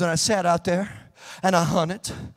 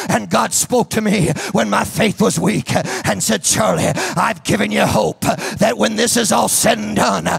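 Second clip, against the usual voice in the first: forceful, punched phrases below a shout; impassioned testimony preaching; 6 semitones higher, 8 dB louder than usual. Real, not acted. Preacher testifying of rejection and God's promise, forceful preaching. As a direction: press each phrase on the congregation.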